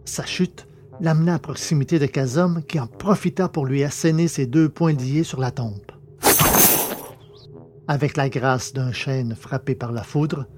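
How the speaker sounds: ordinary delivery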